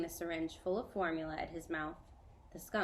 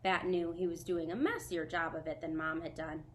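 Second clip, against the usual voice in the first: usual speaking voice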